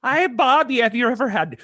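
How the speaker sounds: put-on botched Wisconsin accent, high and loud; hammed-up Wisconsin accent drifting into Irish. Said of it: "you should have a Wisconsin accent".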